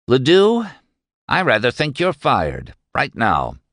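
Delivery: sharp call of the name, then a cool firing; firm dismissal. Acted. Boss firing the driver, controlled and cold. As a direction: Doremus fires Shad for his insolence, firm and cold. Doremus fires his driver.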